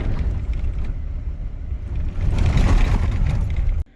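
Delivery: bursting out laughing; big open laugh; loud laugh. Real, not acted. Laughing at the remark.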